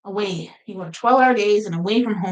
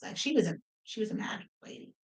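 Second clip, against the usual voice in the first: whisper; 12 dB quieter, faster than usual. Real, not acted. Aside about angry baby.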